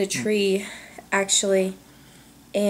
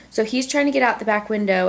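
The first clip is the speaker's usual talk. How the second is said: ordinary talk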